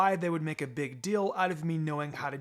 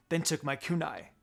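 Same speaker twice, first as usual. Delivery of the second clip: usual speaking voice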